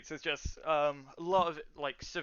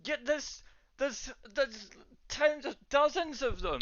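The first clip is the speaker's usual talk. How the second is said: high, stammering townswoman; flustered woman bringing bad news; alarmed barwoman; 11 semitones higher, 4 dB louder than usual. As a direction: stumble over the count in a high voice.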